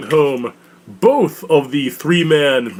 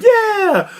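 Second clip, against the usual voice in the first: shrill-free high yelled yeah, much higher; mock crowd cheer. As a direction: cheer each name like a hyped fan.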